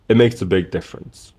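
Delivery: ordinary talk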